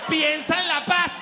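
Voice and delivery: hammed-up shouted answer; mock shout. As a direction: shout it through laughter.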